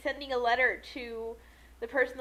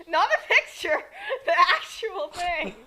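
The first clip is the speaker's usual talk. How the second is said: louder, laughing, slowing on the punchline; 4 semitones higher, 6 dB louder than usual. Real, not acted. Correcting the co-host's joke, laughing. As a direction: giggle out the correction.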